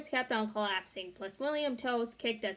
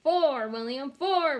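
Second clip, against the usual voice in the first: shouted; 3 semitones higher, 7 dB louder, slower than usual. A narrator's reading. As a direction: cheer the count. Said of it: "we shouted".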